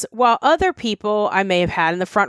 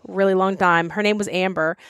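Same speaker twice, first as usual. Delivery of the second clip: ordinary talk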